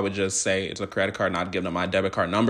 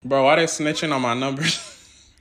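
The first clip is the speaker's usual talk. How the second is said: amused; 5 semitones higher than usual. Real, not acted. Phone menu reads his numbers aloud.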